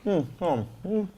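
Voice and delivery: talking with a mouth full; chewing while talking. Real, not acted. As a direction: talk through a mouthful of food. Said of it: "don't talk with your mouth full".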